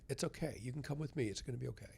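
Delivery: soft, hushed, gently inviting; a dead father coaxing his son softly; soothing whisper. Retold or imitated. Hushed, gentle reassurance from a dead father.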